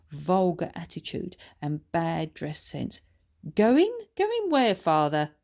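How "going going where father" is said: sharp posh retort; snooty comeback. Acted. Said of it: "he retorted".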